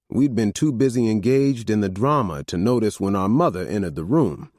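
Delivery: plain reading voice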